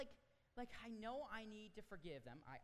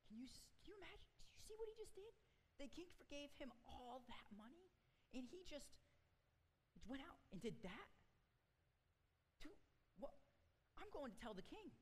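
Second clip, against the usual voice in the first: much higher, fast, shocked chatter; gossiping servant; 9 semitones higher, 3 dB quieter than usual. Acted. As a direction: squeal it like a scandalised coworker.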